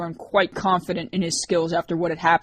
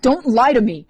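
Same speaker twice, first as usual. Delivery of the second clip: louder, higher sharp snap; snapping; 6 semitones higher, 8 dB louder than usual. A narrator's reading. Liz to Rorik, a rare bold snap. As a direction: bite the words off at him. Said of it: "she snapped".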